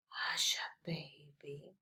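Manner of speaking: soft unvoiced whisper; whispered title. Shown as a demonstration. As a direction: hush it like a lullaby. Hushed song title.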